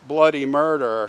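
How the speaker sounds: ordinary delivery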